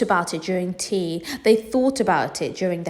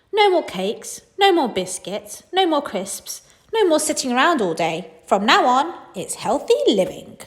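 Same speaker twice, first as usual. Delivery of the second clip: higher, firm laying-down-the-law; bossy mum; 7 semitones higher than usual. Acted. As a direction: mum announcing the diet rules. Mum announces diet.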